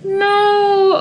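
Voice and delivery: a real crack of tears in a high no; choked-up protest, then steadying; tearful. Real, not acted. Gamer upset over the dad's death, tearful. Reacting to sim's dad dying.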